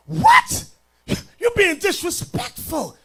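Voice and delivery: very high, loud scandalised shriek, then a shout; mock outrage. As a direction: act the offended churchgoer, overdone. Mock offended listener objecting.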